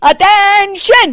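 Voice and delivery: high, drawn-out slow parade-ground shout; mother-in-law calling the house to attention; drill command. Acted. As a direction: bark it like a drill sergeant. Retired policewoman barks command.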